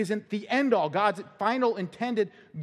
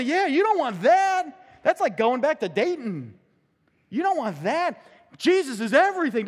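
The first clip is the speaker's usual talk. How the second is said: excited; 7 semitones higher, 7 dB louder than usual. Retold or imitated. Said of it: "I'm gonna say".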